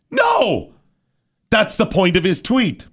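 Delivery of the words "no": sudden very high shouted no; emphatic shout. Real, not acted. Host shouting down left-lane drivers' excuses, sharp yell. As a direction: cut them off with a yell.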